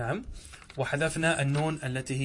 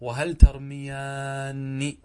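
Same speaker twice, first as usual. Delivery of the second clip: "وهل ترميان" drawn out; teacher modelling the kept alif slowly; very slow model phrase; 4 dB louder, much slower than usual. Shown as a demonstration. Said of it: "فنقول".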